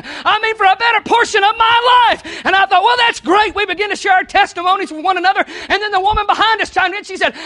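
loud, high, driving preacher's voice; preaching at full force. Acted. Preacher telling the church about the Walmart checkout, loud and high. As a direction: preach it to the back row.

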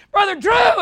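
high loud pleading shout; a homeowner calling out to the pastor; a mock anguished call; anguished cry. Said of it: "you're saying".

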